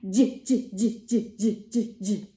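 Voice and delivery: j repeated as a bouncy jiggling sound; j, j, j like a laughing jellyfish; J sound for kids; letter J sound. Shown as a demonstration. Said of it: "make that sound".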